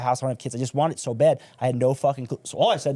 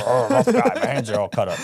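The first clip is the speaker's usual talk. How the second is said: mock tough guy; 4 dB louder than usual. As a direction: play the rough guy for a laugh, then crack up. Jokes about being a man.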